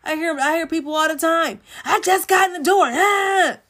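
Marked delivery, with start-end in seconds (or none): 1.8-3.6 high, loud mock complaint; exasperated yell, ending on "Ah!"; imitated irritated outburst. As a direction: imitate a fed-up partner blowing up. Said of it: "that's how they talk".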